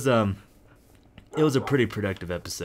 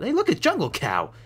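delighted; 9 semitones higher than usual. Real, not acted. Delighted at animal.

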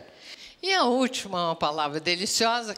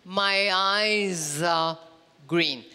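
slow example; 3 semitones lower, much slower than usual. Shown as a demonstration. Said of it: "Vou começar por eye".

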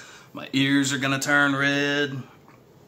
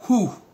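high sharp breath out; quick loud whoosh from the spice; hot pepper exhale; 8 semitones higher, 4 dB louder than usual. Real, not acted. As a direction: blow off the burn. Burn going down his throat.

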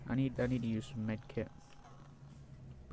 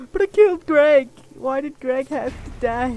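loud, high mock lament, slowing at the end; hammed-up grief; 11 semitones higher, 14 dB louder than usual. Player mourning Greg for his audience, hammed-up mock grief. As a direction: ham up the tragedy for laughs.

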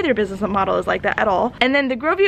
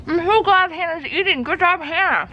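higher, praising cheerleader voice; cheerful encourager; 6 semitones higher than usual. Pretend. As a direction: cheer her on sweetly. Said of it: "try to say things like".

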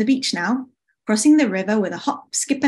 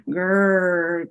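grumbling tummy; 2 semitones lower than usual. Acted. Autumn's hungry tummy interrupts, grumbling growl. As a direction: growl like an empty stomach. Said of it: "grumbled Autumn's tummy".

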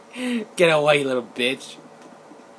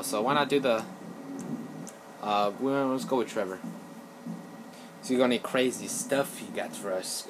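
ordinary talk

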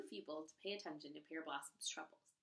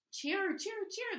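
very high, bright bird calls; chirping sparrows; 13 semitones higher, 7 dB louder, much slower than usual. Acted. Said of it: "the sparrows called".

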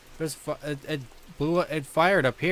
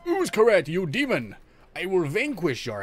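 hammed-up demon-banishing declaration, higher; mock exorcist; 5 semitones higher, 4 dB louder than usual.

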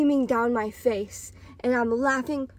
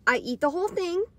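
ordinary talk, nothing marked

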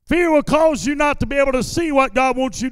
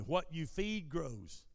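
slow, calm, softer words; slowly and calmly; 7 semitones lower, 17 dB quieter, slower than usual. Shown as a demonstration. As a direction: let each word sink in gently. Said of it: "I'm gonna say this slowly and calmly".

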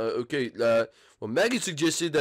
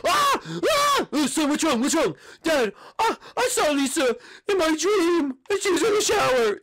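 screaming Bart; 12 semitones higher, 4 dB louder, slower than usual. Acted. Bart wakes screaming from dream.

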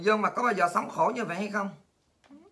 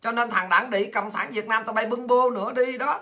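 ordinary talk

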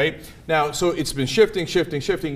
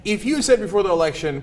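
ordinary delivery

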